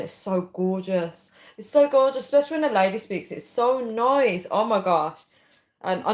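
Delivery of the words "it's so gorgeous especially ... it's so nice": higher and louder, gushing with delight; an excited gush of admiration. Real, not acted. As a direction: swoon over the words so gorgeous. Gushing over Liverpool accent.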